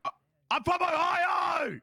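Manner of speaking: hammed-up outraged shout; mock indignant shout. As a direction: yell it out like you're insulted. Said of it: "can't you hear my Ohio accent?".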